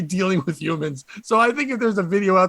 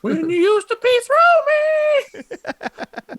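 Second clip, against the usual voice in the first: high, slow nostalgic puppet; squeaky puppet voice; 4 dB louder, much slower than usual. Pretend. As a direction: drag it out high and wistful.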